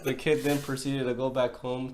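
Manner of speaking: ordinary talk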